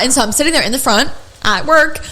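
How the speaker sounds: normal talking voice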